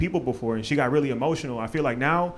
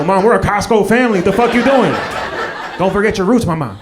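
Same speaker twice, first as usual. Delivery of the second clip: loud, higher exasperated telling-off; mock indignant scolding; 4 semitones higher, 7 dB louder than usual. Son to mom shopping at Whole Foods, mock outrage. Acted scolding of his mother.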